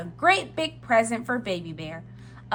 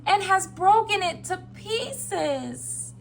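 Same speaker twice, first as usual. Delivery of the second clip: slow, high wee little voice; Baby Bear; 9 semitones higher, slower than usual. A narrator's reading. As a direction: lament it slowly like a tiny cub. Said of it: "in his wee little voice".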